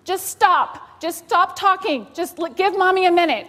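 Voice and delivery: loud, high frazzled snap; a loud, high frazzled snap at the kids; snapping at kids. Retold or imitated. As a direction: cut the kids off, overwhelmed. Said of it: "what was my reaction?".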